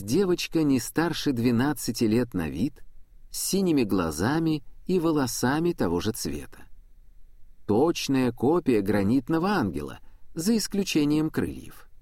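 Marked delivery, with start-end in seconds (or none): none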